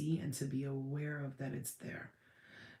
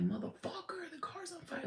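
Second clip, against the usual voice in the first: retold yelling; 14 semitones higher, 4 dB quieter than usual. Driver telling onlookers about the burning car, high mock yelling. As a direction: squeal it in exasperation at softer volume. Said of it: "I was fucking yelling at her".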